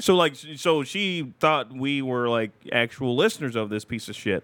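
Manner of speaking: ordinary delivery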